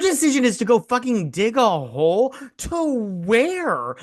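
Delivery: high-pitched incredulous rant; hammed-up telling-off, voice soaring high; exasperated scolding. Telling off Tom for letting Gemma handle this, exasperated.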